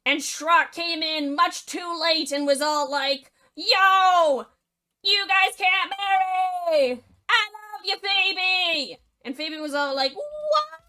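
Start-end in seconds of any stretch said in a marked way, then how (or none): 3.6-9.0 desperate shout. Acted. Shrock interrupts wedding. 10.1-10.8 shocked squeak. Acted. Phoebe hears love confession.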